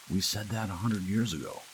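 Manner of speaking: ordinary delivery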